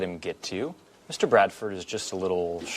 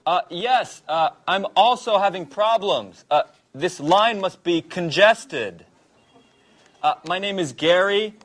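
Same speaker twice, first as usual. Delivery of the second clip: higher, raised phone voice; speaking up; 6 semitones higher, 3 dB louder than usual. Pretend. Prank caller answering a complaint about a terrible line, speaking up. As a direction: project over a bad line, hesitantly. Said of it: "Could you speak up?".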